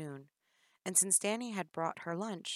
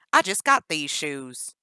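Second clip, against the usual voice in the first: angry complaint; 13 dB louder than usual. Acted. Captor angry about shoes.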